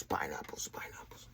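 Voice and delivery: fading whisper. Shown as a demonstration. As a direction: breathe the word out softly. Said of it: "calming word demo".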